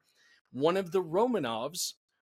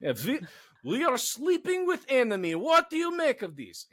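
put-on Russian accent; 9 semitones higher than usual. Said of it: "let's get into Russian".

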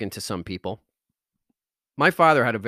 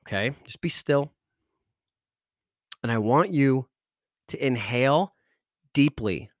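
calm them down and walk them through each breath; guided meditation. Acted. Guided breathing and stillness exercise.

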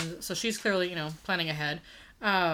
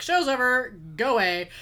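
loud call to the crowd; 4 semitones higher, 6 dB louder than usual. Retold or imitated. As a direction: shout it out to the onlookers. Retold cop dispersing onlookers.

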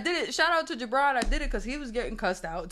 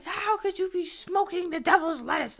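much higher, slow, scandalized mom voice; mock church mom; 10 semitones higher, slower than usual. Mother scolding her daughter for smoking weed, shocked. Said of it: "my mother's like".

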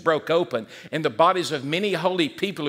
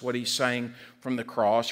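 ordinary talk

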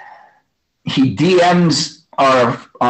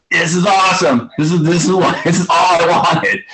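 thrilled; 6 semitones higher than usual. Retold or imitated. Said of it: "I'm just playing and I'm like".

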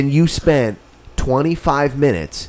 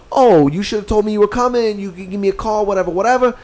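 higher put-on casual voice; imitating the friend; 9 semitones higher, faster than usual. Mocking the friend's breezy offer to detail the car, pitched up. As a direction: toss it off lightly, pitched up.

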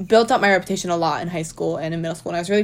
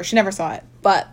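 ordinary delivery

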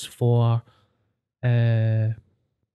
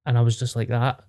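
ordinary delivery

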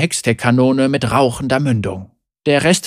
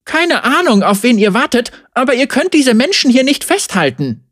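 put-on defiant young heroine; 9 semitones higher than usual. Zeri defies the guard leader, high and bold. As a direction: throw the challenge at the guards.

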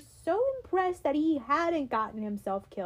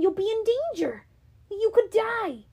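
high, louder, rushing in alarm; alarmed plea; 10 semitones higher, 6 dB louder than usual. Acted. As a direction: panic rising as you warn him. Grover alarmed for Percy.

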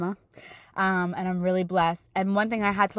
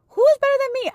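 much higher and louder, near squeal; joyful shriek; 8 dB louder, faster than usual. Retold or imitated. Praising God with mom, shrieking with joy.

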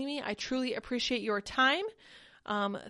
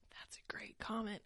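whisper; 13 dB quieter than usual. Real, not acted. Aside while reading comments.

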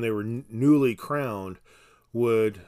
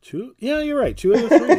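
surprised; 14 semitones higher, 10 dB louder, faster than usual. Real, not acted. Surprised realization about wines.

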